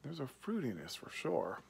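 talking around pipe stem; pipe in mouth. Real, not acted. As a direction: lips clenched on pipe. Seen 1: Pipe held in mouth by hand throughout the labelled words.

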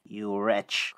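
put-on low, restrained threatening old man; menacing old fiend. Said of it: "cried he".

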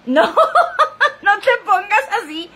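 high, drawn-out no through laughter; laughing protest. Real, not acted. Vlogger reacts to a viewer's name joke, laughing.

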